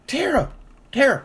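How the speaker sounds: high calling voice of a mother; high two-note mock call of a mother; mom calling out. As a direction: call her name like an embarrassed mom. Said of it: "her mom like".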